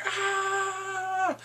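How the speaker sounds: long, high-pitched battle cry; mock scream while rushing in; charging yell. Imitates him rushing in.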